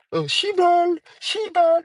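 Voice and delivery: high, loud mock Shiba. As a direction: ham up the annoying trend voice. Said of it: "It's like, shut up".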